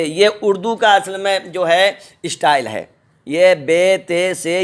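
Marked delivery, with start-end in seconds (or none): 3.3-4.6 "بے، تے، سے" with Urdu -e endings; Urdu letter names. Shown as a demonstration. Teacher shows the style to avoid, Urdu-style "بے، تے، سے". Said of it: "یہ اردو بھاشا کا اسٹائل ہے".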